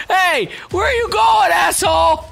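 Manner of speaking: outraged shout. Real, not acted. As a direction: bellow at the enemy as he runs. Chasing the fleeing enemy.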